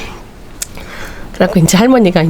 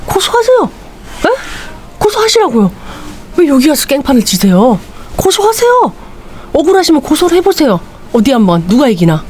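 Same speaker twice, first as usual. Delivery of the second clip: very high, sneering, dismissive; doctor coolly mocking the ranting patient; defiant doctor retort; 7 semitones higher than usual. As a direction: dare him to sue.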